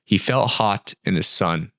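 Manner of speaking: plain reading voice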